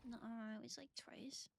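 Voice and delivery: soft whispered denial; whispering. Real, not acted. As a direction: whisper the objection.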